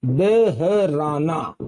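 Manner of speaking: "बहराना" very slowly, syllable by syllable; correct pronunciation. Shown as a demonstration. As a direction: sound out "बहराना" as beh, her, raa, naa. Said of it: "क्या बोलते हैं".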